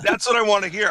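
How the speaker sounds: normal talking voice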